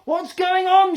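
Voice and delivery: high, loud alarmed shout. A narrator's reading. Dorcas shouting from the lorry, high and loud. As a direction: demand it over the noise. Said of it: "shouted Dorcas".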